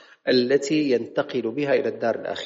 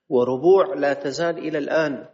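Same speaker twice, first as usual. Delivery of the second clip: ordinary talk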